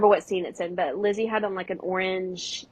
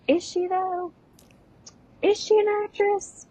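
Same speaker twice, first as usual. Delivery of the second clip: high mocking question; sarcastic doubt; 10 semitones higher than usual. Real, not acted. Mocking Miranda's acting, high sarcastic questioning. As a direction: needle the claim.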